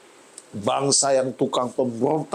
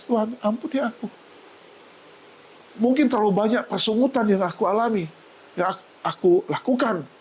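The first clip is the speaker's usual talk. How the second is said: retold tearful prayer; 7 semitones higher than usual. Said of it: "saya menangis".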